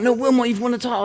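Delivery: high mock coach voice; mock insistent coach; coach insisting. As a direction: urge him that he's won, up high. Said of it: "He's like".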